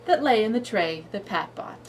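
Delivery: lively singsong read-aloud; reading to a toddler. Shown as a demonstration. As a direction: read it bouncy for a little kid. Reads cumulative story aloud.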